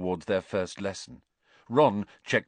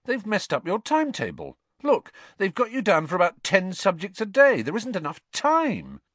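disbelieving; 11 semitones higher, 4 dB louder than usual. A narrator's reading. Ron frowning at her timetable.

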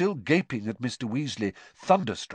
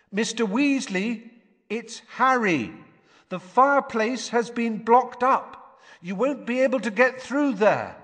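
calling out; 12 semitones higher, 4 dB louder than usual. Acted. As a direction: call through the boarded fireplace. Calling through blocked fireplace.

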